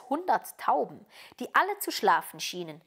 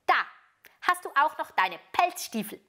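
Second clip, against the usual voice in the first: put-on higher-pitched, brisk robber girl; bossy robber girl; 8 semitones higher, 4 dB louder than usual. A narrator's reading. Robber girl giving Gerda back her boots, brisk. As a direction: hand it over like a bossy child.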